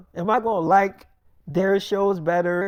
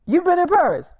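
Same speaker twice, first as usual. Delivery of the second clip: much higher, louder astonished question; shocked question; 9 semitones higher, 5 dB louder than usual. Retold or imitated. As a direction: blurt it out in disbelief. Partner shocked he's been there.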